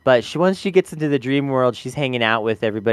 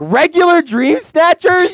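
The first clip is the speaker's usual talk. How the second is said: much higher, louder disbelieving echo of "Regular Dream Snatchers"; incredulous mock echo; 7 dB louder, slower than usual. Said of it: "And I'm like".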